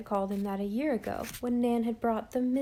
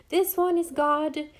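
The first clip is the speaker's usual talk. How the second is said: imitating a child; 6 semitones higher, 6 dB louder than usual. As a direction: mimic an innocent little boy showing off. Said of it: "he said, poor innocent child".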